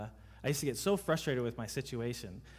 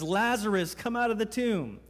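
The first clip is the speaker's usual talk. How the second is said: raised, higher call into the tomb; 6 semitones higher, 3 dB louder than usual. Retold or imitated. Jesus calling his dead friend out of the tomb, raised commanding call. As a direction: call him out with authority.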